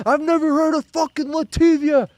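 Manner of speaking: high loud yell, Latvia said luh-TIV-ee-uh; New York mispronunciation. Retold or imitated. Streamer quoting the fan's Latvia line again. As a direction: mimic the fan shouting luh-TIV-ee-uh. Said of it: "And it's Latvia, obviously".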